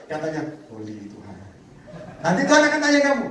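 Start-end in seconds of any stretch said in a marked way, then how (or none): 0.7-1.4 much softer and lower, sanctimonious; mock pious murmur. Preacher mocks a greedy man's pious prayer, hushed. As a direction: breathe it out in fake devotion.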